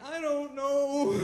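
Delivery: slow, laughing, high and awkward; nervous laugh. Retold or imitated. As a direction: stammer it out through a laugh. Nervous self quoted, laughing.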